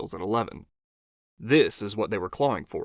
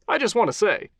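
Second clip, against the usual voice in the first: higher, upbeat host voice; talk-show host Johnny; 10 semitones higher than usual. Acted. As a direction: charm the guest as a TV host.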